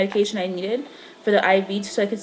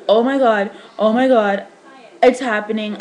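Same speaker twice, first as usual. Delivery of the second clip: louder, rising alarm as it hits her; panicked gasp; 6 dB louder than usual. Retold or imitated. Woman in labor hearing her dilation, panicked. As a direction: blurt it out in panic as labor starts.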